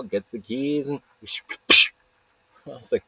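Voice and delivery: ordinary talk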